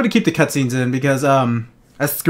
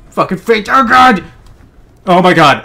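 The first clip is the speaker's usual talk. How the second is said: excited shout; 4 semitones higher, 5 dB louder than usual. Real, not acted. Landing a jump.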